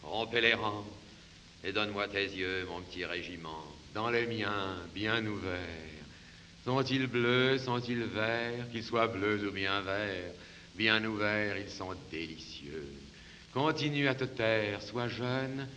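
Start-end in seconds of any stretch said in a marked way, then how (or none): 1.6-3.6 much softer, lower and tender; soft tender aside. Acted. 11.6-12.9 low, much softer and admiring; soft admiring murmur. Acted. Admiring her eyes.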